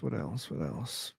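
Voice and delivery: trailing off into a low mumble; muttering to himself. Real, not acted. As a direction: mumble the question under your breath. Musing to himself, fading.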